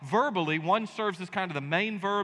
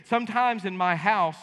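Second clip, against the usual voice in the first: ordinary delivery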